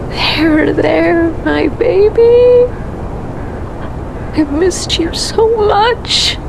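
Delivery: put-on high, swelling coo to a baby; tearful cooing. Mother holding her missed baby, high tender cooing. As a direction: let longing lift the voice high.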